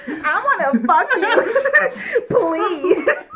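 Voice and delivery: high, loud plea breaking into laughter; hammed-up begging while cracking up; mock begging. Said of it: "begged her on his knees".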